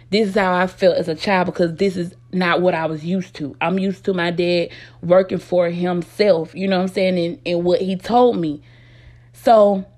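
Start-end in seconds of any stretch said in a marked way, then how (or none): none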